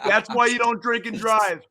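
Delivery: laughing. Real, not acted. Host laughing while mocking the singer's arrest.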